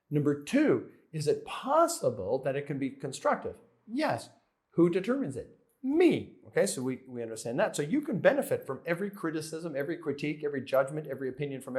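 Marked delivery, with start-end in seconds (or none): 5.8-6.3 Me punched high and loud; a sharp, high Me; emphatic answer. Real, not acted. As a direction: hit Me hard and high.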